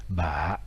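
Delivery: ba très grave, plus bas que l'accent grave; ba enfoncé tout en bas; heavy low tone. Shown as a demonstration. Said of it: "Donc ça se prononce ici". (ba avec accent très grave)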